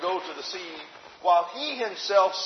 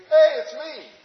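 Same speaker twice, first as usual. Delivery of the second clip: calling out; 11 semitones higher, 9 dB louder than usual. Pretend. Voice calling from the water.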